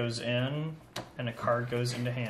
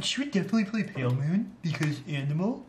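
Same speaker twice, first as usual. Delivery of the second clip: voicing the cat; 5 semitones higher, 3 dB louder, faster than usual. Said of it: "so they see her and be like".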